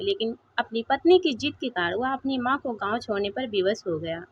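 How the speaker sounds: usual speaking voice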